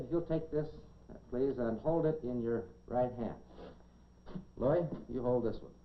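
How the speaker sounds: normal talking voice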